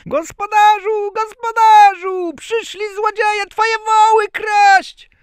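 very high, piercing yell; Bździelik. A narrator's reading. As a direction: scream the alarm as a tiny boy. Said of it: "i wrzasnął". (przeraźliwy, piskliwy wrzask malca)

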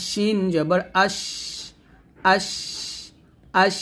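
আশ with a long hissing sh at the end; airy shin. Shown as a demonstration. Teacher showing learners shin, releasing air on each ending. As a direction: let air stream out through the sh of আশ. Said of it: "বাতাস বের করবেন".

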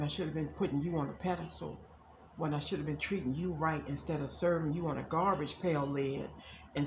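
channeling an ex's apology. Acted. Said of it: "That's what a lot of them are feeling".

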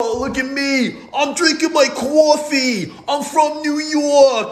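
high, slow hammed-up New Yorker; mocking imitation. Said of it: "are you making fun of me".

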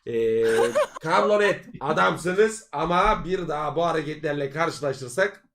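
ordinary talk, nothing marked

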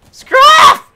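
high, very loud screaming at the dog; shouting down a dog that won't stop; furious yell. Acted. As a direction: scream it at full force. Yelling at a dog.

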